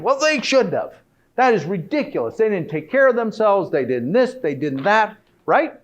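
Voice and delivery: loud, high, indignant rant; mock anger. Speaker acts out a mourner's anger at the deceased, loud rant. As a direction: blow up as if furious at the one who died.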